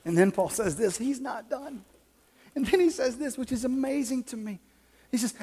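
ordinary talk

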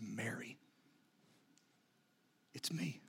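soft, near-whispered recognition; hushed and tender. Acted. Jesus softly calling Mary by name, hushed and tender. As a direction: say her name softly, barely above a whisper.

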